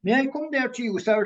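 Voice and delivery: high, playful Irish lilt; best Irish accent. Retold or imitated. Medium greeting a difficult old man from the pulpit, best Irish accent. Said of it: "in my best Irish accent".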